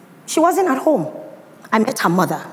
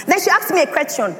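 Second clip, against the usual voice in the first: ordinary talk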